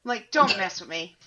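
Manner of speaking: quiet, low threat; a hushed don't-mess-with-me; low warning. Retold or imitated. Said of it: "she's just like".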